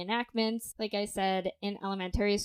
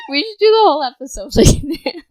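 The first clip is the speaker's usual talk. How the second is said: laughing; 8 semitones higher, 17 dB louder, faster than usual. Real, not acted. Still laughing at voice effect.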